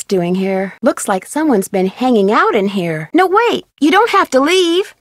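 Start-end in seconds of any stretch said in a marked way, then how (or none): 3.1-5.0 high urgent call after someone; pleading call to stop someone leaving. Acted. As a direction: call them back before they go.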